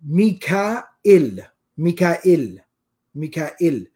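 Mikail broken up as mee-kaa-IL; other pronunciation. Shown as a demonstration. Teacher demonstrating Nafi's recitation, Mikail split as mee-kaa-il. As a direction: say Mikail with a short il after a catch. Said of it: "The other pronunciation of it is".

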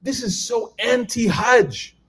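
high, slow, drawn-out grumble; mock indignant complaint. Young pilgrims grumble about luxury at Hajj, slow mock indignation. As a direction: play the outraged pilgrim, hammed up. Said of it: "these guys are just like".

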